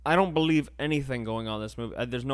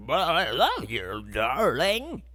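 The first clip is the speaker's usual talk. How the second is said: slow, louder hammed-up crooning; mock romantic hero; 3 semitones higher, 5 dB louder, slower than usual. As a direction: lay on the old-movie charm. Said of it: "And he's like".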